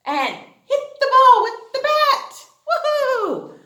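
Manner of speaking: high, loud whoop; excited cheer. Acted. Teacher cheering an imagined baseball hit for kids, high and loud. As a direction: cheer like a home run.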